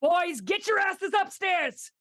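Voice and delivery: high, full-force holler; urgent yell. Acted. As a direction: yell upstairs orders like a fed-up mom. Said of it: "she, she yells".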